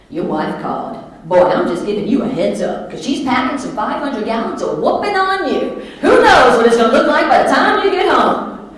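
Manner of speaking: loud Southern warning, building to a high holler; hammed-up Southern twang, building to a big finish; Shirley the Stress Buster. Alter ego warning husband of his wife's wrath, Southern twang. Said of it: "as Shirley the Stress Buster".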